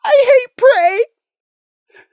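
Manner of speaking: slow, high, sobbing. Acted. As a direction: wail it through tears. Prey's self-hatred.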